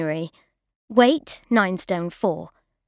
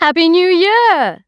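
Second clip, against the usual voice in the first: loud, high jolly greeting; hearty host; 9 semitones higher, 7 dB louder, slower than usual. A narrator's reading. Host greeting a late guest, loud and jolly. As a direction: boom it out like a jovial uncle. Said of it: "said Geoffrey Elcombrey".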